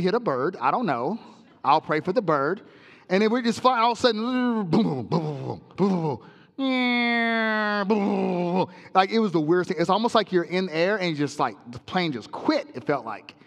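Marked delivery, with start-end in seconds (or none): 4.7-8.7 thump out the turbulence; imitated turbulence booms.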